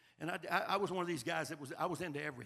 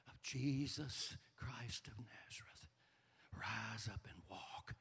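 slow, hushed whisper; solemn whisper; 7 semitones lower, 10 dB quieter, much slower than usual. Acted. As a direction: breathe the command slowly. Peter heals the beggar.

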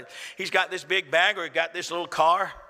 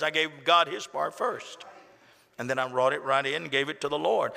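ordinary talk, nothing marked

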